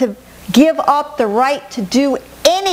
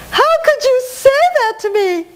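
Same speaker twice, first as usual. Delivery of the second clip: much higher, wounded whining voice; whiny hurt reply; 13 semitones higher than usual. Retold or imitated. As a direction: whine like someone deeply offended. Voicing offended loved one.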